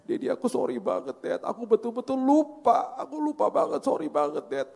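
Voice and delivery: high-pitched pleading mock cry; hammed-up tearful apology; imitated tearful child. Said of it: "Terus dia nangis".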